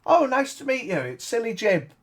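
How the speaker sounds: high, bright clown voice; silly clown. Acted. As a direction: sing it out like a goofy clown. GM voicing clown Silly Jim.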